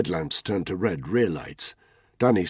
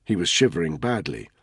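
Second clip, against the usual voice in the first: plain reading voice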